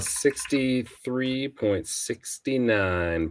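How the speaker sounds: slow. Real, not acted. Reading out the percentage.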